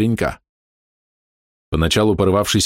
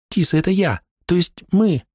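stage fright; 11 semitones higher than usual. A narrator's reading. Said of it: "заикаясь от волнения".

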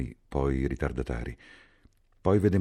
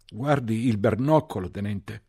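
high, loud, slow shout with a put-on voice; 9 semitones higher, 6 dB louder, slower than usual. A narrator's reading. Bloodied soldier shouts to the lieutenant from the roadside, high loud call. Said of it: "gridò".

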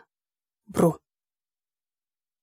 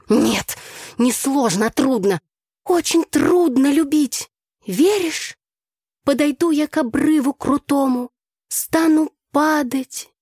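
higher, drawn-out verse; expressive declamation; 8 semitones higher, slower than usual. A narrator's reading. Tasya carries on with her rehearsed love poem, declaiming. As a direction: keep reciting, stretching the last words. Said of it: "выразительно продекламировала Тася".

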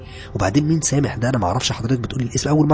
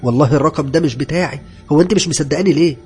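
higher, louder denial; indignant protest; 6 semitones higher, 8 dB louder than usual. Acted. Maid protesting to Nermin that it isn't her number. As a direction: insist you're innocent.

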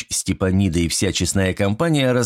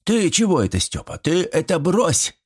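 put-on high, flustered old villager; taken aback, lightly mumbled protest; flustered muttering; 10 semitones higher than usual. A narrator's reading. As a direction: back off in confusion, voice pitched up. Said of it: "растерянно забормотал Митрич".

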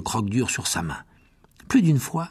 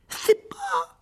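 very high panicky squeak; frightened. A narrator's reading. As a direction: start squealing it, then get cut off. Terrified Ron answers, cut off. (cri suraigu de frayeur)

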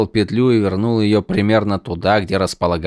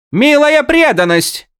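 slow high sneer; snide; 6 dB louder than usual. Acted. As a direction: sneer it mockingly. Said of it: "съехидничал Виндель".

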